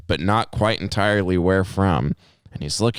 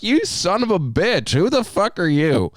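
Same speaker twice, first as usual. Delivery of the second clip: high, drawn-out mock outrage; mock indignation; 11 semitones higher than usual. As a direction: protest with exaggerated disbelief at the teasing. Acted indignant reply to teasing.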